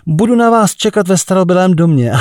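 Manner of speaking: lightly voiced woman calling out; cried out. A narrator's reading. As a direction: call it out down the line. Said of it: "vykřikla".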